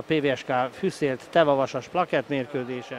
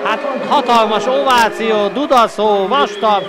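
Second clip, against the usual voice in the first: excited; 5 semitones higher, 5 dB louder than usual. Real, not acted. Crowd cheering after goal.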